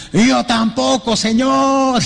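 high, slow, teasing; lightly voiced friend answering with a grin; the friend's reply. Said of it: "dijo el otro".

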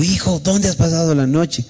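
lightly acted mother asking after her child; mother's retold exclamation. Mother finds her missing child.

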